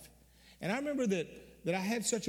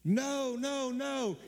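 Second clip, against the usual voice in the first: retold scream; 8 semitones higher, much slower than usual. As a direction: call out desperately to stop him leaving. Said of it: "I heard Kerry scream".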